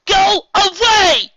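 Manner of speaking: slow loud high scream. A narrator's reading. Twilight screams at her friends to leave. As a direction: scream them away. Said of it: "screamed into the sky".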